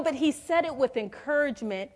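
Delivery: ordinary talk, nothing marked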